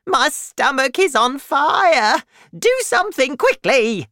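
put-on high, loud panicked old grandma; shouting. A narrator's reading. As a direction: yell urgently for help, high and loud. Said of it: "she shouted suddenly".

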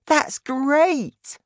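put-on very high child's exclamation; delighted girl. A narrator's reading. Narrator voices Sophie.

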